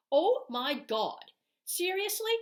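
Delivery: slow, loud, rising to high outrage; real indignation; indignant disbelief. Real, not acted. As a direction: react as if you can't believe it. Outraged reaction to the remark.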